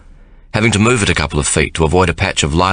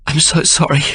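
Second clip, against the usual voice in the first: tearful; 9 semitones higher than usual. A narrator's reading. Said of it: "she says tearfully".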